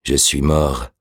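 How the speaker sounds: lightly voiced revived corpse; dead man's murmur. A narrator's reading. The revived man answers his awakener, lightly murmuring. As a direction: state it lifelessly, like a murmur. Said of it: "murmura-t-il".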